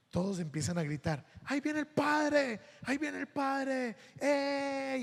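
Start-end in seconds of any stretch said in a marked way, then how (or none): 1.5-2.6 put-on excited children. Children shout at priest arriving. 2.8-5.0 put-on high child voice, softer and slow; excited children. Children shout at priest arriving.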